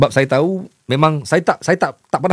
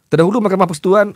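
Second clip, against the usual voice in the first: higher, rushed start that breaks off; failed reading attempt; 6 semitones higher, 3 dB louder, faster than usual. Real, not acted. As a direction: rush the opening words, then give up. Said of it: "nampak, tak boleh".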